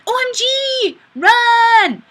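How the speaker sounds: high, loud panicked shriek; mock panic. Streamer reading a viewer's comment in a mock panicked shriek. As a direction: shriek it in fake terror.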